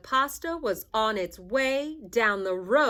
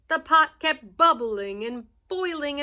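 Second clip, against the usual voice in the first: usual speaking voice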